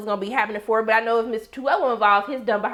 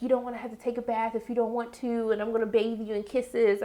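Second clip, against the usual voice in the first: tender mother; 7 dB quieter than usual. Retold or imitated. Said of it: "she was like".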